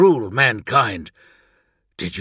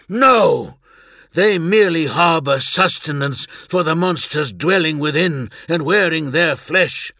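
a sharp, higher "No!" then a firm, cutting warning; hard rebuttal; 7 semitones higher, 5 dB louder than usual. Acted. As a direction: shut him down like a veteran who has seen it. Narek rejects Elias's claim.

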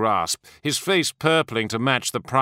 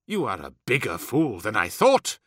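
contemptuous; 2 semitones lower than usual. A narrator's reading. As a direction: dismiss him as a fool. Primarch sneers at officer.

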